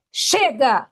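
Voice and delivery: forceful cry of release; demonstrated shout. Shown as a demonstration. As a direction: shout it out to break free. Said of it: "eu vou pedir para você gritar". (grito de basta)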